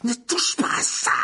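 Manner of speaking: high, slow furious cry; roaring. Acted. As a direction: shout the cat off the wand. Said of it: "rugit Harry".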